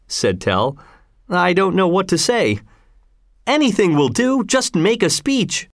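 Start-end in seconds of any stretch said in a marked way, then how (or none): 1.3-2.6 higher-pitched, helpless demurral; mildly embarrassed refusal; flustered protest. A narrator's reading. Embarrassed Tell declines a speech. 3.5-5.7 high, eager coaxing; crowd urging. A narrator's reading.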